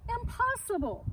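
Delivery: a high incredulous cry; disbelief. Acted. Farmer shocked by typing cows, incredulous cry. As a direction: Farmer Brown can't believe it, high and sharp.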